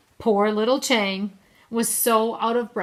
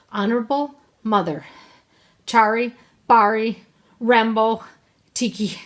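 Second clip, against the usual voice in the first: loud, broken, out-of-breath bursts; winded little boy pausing between words; gasping child; 3 semitones higher, 7 dB louder than usual. A narrator's reading. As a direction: struggle to get each word out. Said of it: "He gasped".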